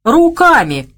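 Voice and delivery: loud, high jeering retort; mocking sneer. A narrator's reading. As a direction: throw it back with a smirk. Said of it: "усмехнулся тот".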